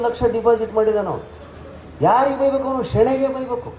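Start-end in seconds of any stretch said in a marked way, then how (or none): none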